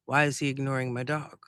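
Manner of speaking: usual speaking voice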